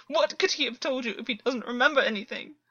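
distressed outburst; rising distress. A narrator's reading. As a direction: voice climbing in alarm. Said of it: "voice rising in distress".

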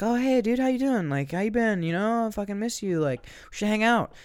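high, loud and over-friendly; gushing fake-friend greeting. Unwanted friend greets someone who avoids them, gushing.

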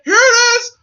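high, loud touchdown call; excited announcer call. Retold or imitated. Announcer calling the suplex a touchdown, loud excited call. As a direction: belt it like a football play-by-play man.

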